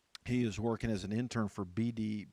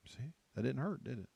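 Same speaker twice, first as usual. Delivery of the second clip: normal talking voice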